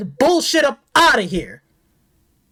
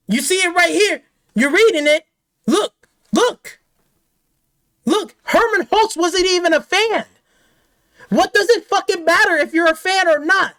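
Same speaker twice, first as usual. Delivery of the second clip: ordinary talk, nothing marked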